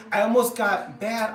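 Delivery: talking through hiccups. Real, not acted. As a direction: hiccup breaks the words. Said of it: "I got the hiccups at the same time".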